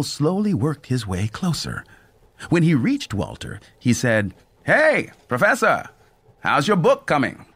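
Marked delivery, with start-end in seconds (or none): 4.6-7.5 cheerful conductor voice. A narrator's reading. Conductor greets Walter.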